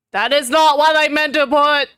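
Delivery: loud, high, caught off guard; startled outburst. Real, not acted. Streamer realises she uploaded the wrong picture, loud and startled. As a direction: blurt it out in shock.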